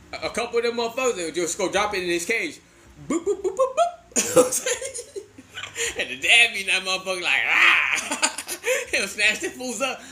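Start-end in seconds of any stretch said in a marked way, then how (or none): 3.1-4.7 high, slow little boops; playful high-pitched sound effect; imitated dropping noises. Imitates dropping babies into cage. 7.5-8.3 loud, lower animal snarl; comic monster growl; imitated attack roar. Imitates father hamster attacking.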